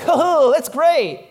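high, loud fake enthusiasm; hammed-up, breezy approval; mock cheerful approval. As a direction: imitate a friend gushing uncritically. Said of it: "and they'd be like".

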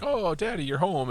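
high, sweet family welcome; family greeting dad. Retold or imitated. Family greeting Nick Cage, a high sweet welcome. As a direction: greet daddy like a happy child. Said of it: "his family is like".

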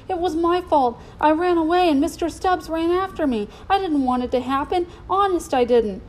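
high-pitched pleading; distraught confession. A narrator's reading. Boy confesses to the colonel that he ran away, distraught. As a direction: blurt it out, desperate to be believed.

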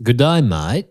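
mock Aussie greeting; broad-vowelled Australian hello; Australian accent. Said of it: "try to do an Australian accent".

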